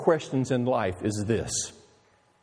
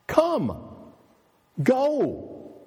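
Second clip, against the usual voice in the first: higher, ringing commands of Jesus; Jesus commanding; 11 semitones higher, slower than usual. Acted. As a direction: call out each command like Christ summoning. Preacher voicing Jesus' commands.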